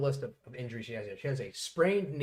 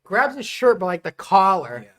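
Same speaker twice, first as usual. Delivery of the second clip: excited retelling; 5 semitones higher, 11 dB louder than usual. Real, not acted. Retelling a drunk threat.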